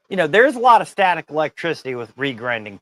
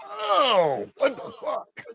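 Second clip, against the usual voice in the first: higher, startled shout; shocked yelp; 9 semitones higher, much slower than usual. Retold or imitated. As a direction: cry out in sudden shock. Flew over table, shocked.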